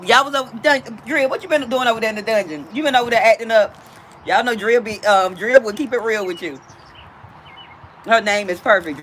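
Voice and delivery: ordinary delivery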